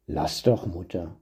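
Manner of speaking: low, soft grumbling father; father grumbling at his weepy wife. A narrator's reading. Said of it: "brummte er".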